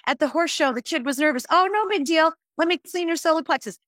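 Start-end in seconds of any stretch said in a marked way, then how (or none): 1.5-3.8 higher, bright-sounding casual reassurance; breezy reassurance. Retold or imitated. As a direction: brush off the kid's nerves lightly. Breezily reassuring a nervous kid.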